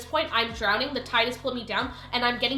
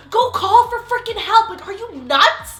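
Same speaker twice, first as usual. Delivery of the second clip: angry outburst; 8 semitones higher, 9 dB louder than usual. Real, not acted. Angry rant at bystanders.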